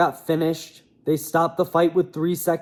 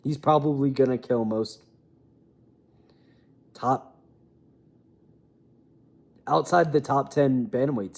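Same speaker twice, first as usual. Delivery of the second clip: normal talking voice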